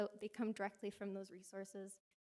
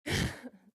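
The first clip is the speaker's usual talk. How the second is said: nervous laugh; 4 semitones higher, 10 dB louder than usual. Real, not acted. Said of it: "Sorry, my voice is shaking".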